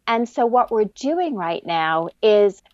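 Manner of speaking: ordinary talk, nothing marked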